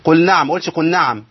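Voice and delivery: قل نعم first with the ل swallowed, then with a clear ل; merged then correct. Shown as a demonstration. Teacher contrasting a swallowed lam with a clear one. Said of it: "فين اللام".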